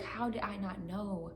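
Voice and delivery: quiet, shaken repeating of the same question; emotional repeating. Retold or imitated. Retelling her dream, she quietly repeats her realization, softly. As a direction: murmur it to yourself, overwhelmed. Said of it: "and I was just emotional".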